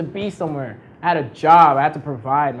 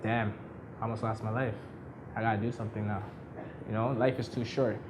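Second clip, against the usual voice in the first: softer, sober murmur of realisation; shaken inner thought; 6 semitones lower, 13 dB quieter than usual. Retold or imitated. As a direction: speak it low, as if it just sank in. Shaken after car accident.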